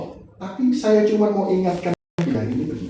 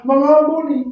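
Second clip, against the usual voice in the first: high, loud preachy sing-song; loud pulpit voice mocking himself; mock preaching; 9 semitones higher, 7 dB louder than usual. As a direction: ham up the easy pulpit line. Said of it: "Saya dengan gampang ngomong dari mulut saya".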